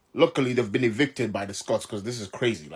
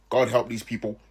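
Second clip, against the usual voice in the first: ordinary talk, nothing marked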